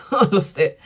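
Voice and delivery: laughing at herself; a laugh, shrugging it off. Real, not acted. Laughs at losing her thought.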